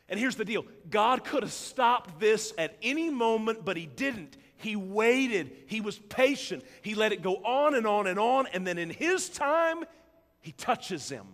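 loud, rising preacher's build; preaching at full force. Acted. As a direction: drive it home to the congregation.

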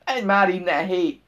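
loud burst of exasperation; angry outburst. A narrator's reading. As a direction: drive him off with a shout. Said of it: "tört ki belőle".

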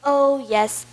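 ordinary talk, nothing marked

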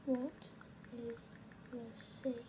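halting, one word at a time; typing aloud. Real, not acted. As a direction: leave gaps as if typing each word. Saying words while typing a text.

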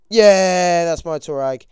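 long, high, loud cheer; triumphant shout. Real, not acted. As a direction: shout it out in victory. Celebrating a kill.

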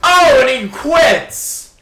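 high, loud, slow mock cheer; sarcastic outburst. Real, not acted. Gamer mocking a teammate who quit, sarcastic loud cheer. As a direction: drip sarcasm, loud and drawn out.

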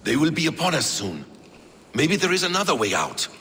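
urgent alarm. Acted.

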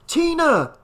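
high-pitched cry after someone. A narrator's reading. Father running after his fleeing daughter, high cry. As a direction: call out in a high, urgent voice. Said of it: "he cried".

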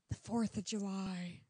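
slow breathy Southern drawl; drawn-out dreamy Southern lady; Jennifer Coolidge. Retold or imitated. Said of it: "and she's like".

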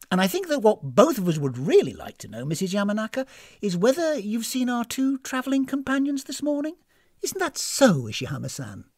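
high, insistent questioning; pointed, suspicious guest. Acted. Guest asking hostess about missing companions, pointed. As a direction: press the question with pointed politeness.

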